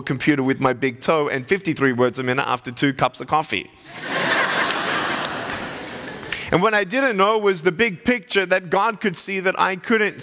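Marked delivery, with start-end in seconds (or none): 3.9-6.5 an airy burst of laughter; soft unvoiced chuckle; breathy laugh. Real, not acted. Laughs at his own toe typing.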